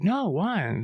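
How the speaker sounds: put-on high little boy asking; lightly voiced curious child; curious kid voice. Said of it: "And he's like".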